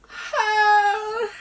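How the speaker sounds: high, loud, held "ah"; excited cry. Real, not acted. Shopper seeing the bag's colour, high delighted cry. Thrilled at first sight of bag.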